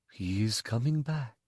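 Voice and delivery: low, soft and gentle, a mother's hush; deep soft voice. Acted. The mother reassures the injured boy, deep and soft. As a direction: murmur it softly like a healer's comfort. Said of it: "in her deep, soft voice".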